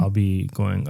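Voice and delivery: ordinary delivery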